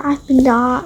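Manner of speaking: ordinary delivery, nothing marked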